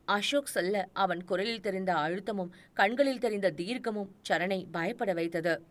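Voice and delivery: ordinary delivery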